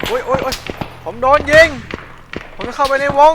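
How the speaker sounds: loud high cries of pain; hammed-up wounded soldier; pained yelling. Getting shot in game.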